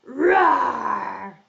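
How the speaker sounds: big rough lion's roar. Shown as a demonstration. Teacher shows children the story cue, big lion roar. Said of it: "when you hear lion".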